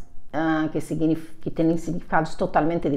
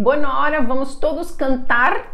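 lightly imitated teacher, raised pitch; drama teacher; 6 semitones higher than usual. Drama teacher setting a singing task, lightly voiced instruction. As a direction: announce an exercise as a teacher would. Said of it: "y la profe dijo".

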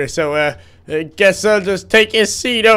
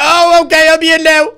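much higher, louder eager voice; excited; 10 semitones higher, 5 dB louder than usual. Real, not acted. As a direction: burst in full of excitement. Called in, excited.

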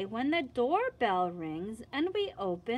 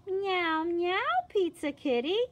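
higher, louder cat meow; meowing kitty; 8 semitones higher, 4 dB louder than usual. Acted. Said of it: "Then what will you say?".

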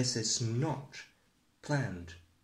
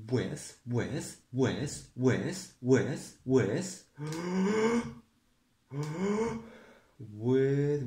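stuck repeating; slower than usual. Acted. Host stuck repeating word.

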